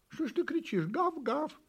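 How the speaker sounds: high, hurt little kitten; offended. A narrator's reading. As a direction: complain in a high, huffy voice. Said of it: "обиделся котёнок". (обиженный котёнок)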